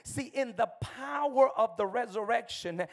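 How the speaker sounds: ordinary delivery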